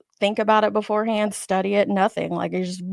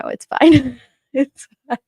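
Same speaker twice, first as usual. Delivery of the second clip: ordinary delivery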